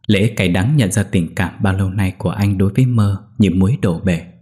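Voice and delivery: plain reading voice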